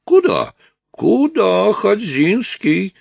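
very high, loud, drawn-out call; loud, stretched-out high challenge. Acted. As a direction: call after the fugitive in a high put-on voice. Strus calls after the escaping man.

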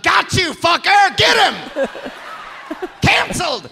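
a guy jumping up from his car, hammed-up triumphant shout; gleeful shout. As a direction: yell it like catching someone red-handed. Retold guy stands up yelling.

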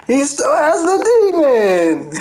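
high, slow wail with laughter under it; mock dismay. Retelling shock at a rival's demon, mock dismayed. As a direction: wail it slowly, hammed-up disbelief.

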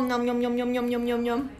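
rapid monotone nom-nom munching; pretend munching. Teacher pretending to eat the apple, playful munching. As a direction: gobble an imaginary apple.

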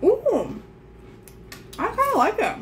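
talking with mouth full; chewing. Real, not acted. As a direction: between bites of biscuit. Seen 0: Left speaker chews biscuit, cheeks full, while saying "Ooh, I like it.

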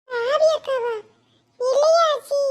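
put-on a small kid asks innocently; child voice. Child answers grandmother. (சிறுவனின் உயர்ந்த குரல்)

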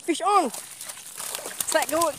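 high loud strike call; excited call. Real, not acted. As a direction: shout it out. Another strike. (jeritan teruja)

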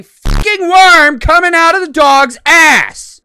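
slow, high, very loud outburst; appalled shout. Real, not acted. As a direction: shout it in disbelief, word by word. Disgusted outburst at the footage.

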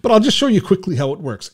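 ordinary delivery

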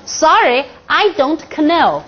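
"know" said as "kuh-noh", slowly; K wrongly pronounced. Shown as a demonstration. Teacher showing what nobody ever says, k sounded. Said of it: "从来没有人说".

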